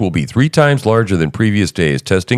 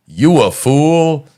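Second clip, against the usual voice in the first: drawn-out, higher-pitched "fool"; mimicked official's insult; 6 semitones higher, much slower than usual. Host repeats an official's insult from the clip, mocking. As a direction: echo the irritated official incredulously, stretched slow. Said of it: "Did he say".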